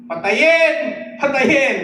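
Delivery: very loud, high chant-leader's yell; rallying shout. Shown as a demonstration. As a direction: shout it so the congregation joins in. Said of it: "Kaya isigaw natin". (malakas na sigaw na nanghihikayat)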